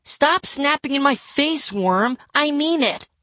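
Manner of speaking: put-on very high-pitched angry kid; angry screaming. A narrator's reading. Livy threatens Bradley after he snaps, shrill anger. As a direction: scold him at a shriek.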